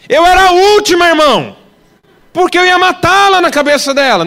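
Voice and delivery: high, loud outcry from the pulpit; preaching shout. Real, not acted. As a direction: cry it out to the church. Preaching peak, raised voice.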